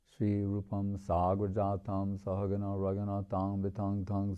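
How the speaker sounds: soft, steady chanting of Sanskrit verses; prayer recitation. Acted. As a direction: recite the prayers quietly and evenly.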